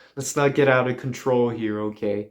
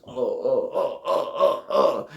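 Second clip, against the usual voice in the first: short repeated moans rising in pitch; mock moaning. Comic imitating wife in the cabin, mock moans. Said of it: "she does".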